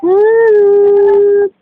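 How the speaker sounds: loud, high, drawn-out hello. Real, not acted. Adult greeting the baby playfully.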